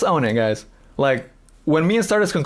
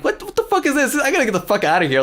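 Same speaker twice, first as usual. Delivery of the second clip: mock outrage; 8 semitones higher than usual. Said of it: "she started getting all, chest up".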